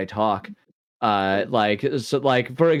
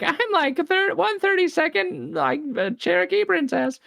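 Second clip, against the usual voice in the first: very high mock voice, claiming Cherokee princess ancestry. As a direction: squeak it out in a silly high parody. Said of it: "be like".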